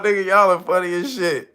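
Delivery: ordinary delivery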